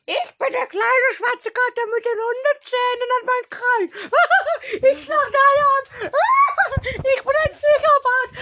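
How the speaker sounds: high squeaky villain cat voice, laughing; cackling cartoon psychopath cat; Geißel voice. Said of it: "Geißel ist einfach so".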